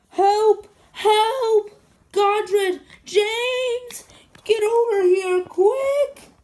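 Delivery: high, loud desperate calls for help; shouting for help. Acted. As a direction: yell for rescue at the top of your voice. Calling for help after crash.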